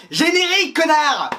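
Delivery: voice-off heckler yelling at the host; rude voice-off shout. Acted. Voice-off heckling the host, loud insulting yell. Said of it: "Tu es une voix off".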